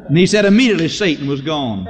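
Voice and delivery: ordinary delivery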